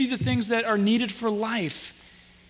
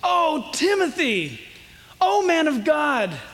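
slow, raised, drawn-out appeal without real sobs; Paul's weeping plea; 4 semitones higher, slower than usual. Acted. Preacher voices Paul weeping over Timothy, mildly acted plea. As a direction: plead slowly in a higher pitch, mildly acted. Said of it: "Paul is weeping at this point".

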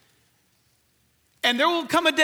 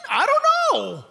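high shrug; 11 semitones higher, 3 dB louder than usual. Real, not acted. Rhetorical shrug about mansions.

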